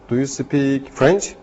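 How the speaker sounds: ordinary talk, nothing marked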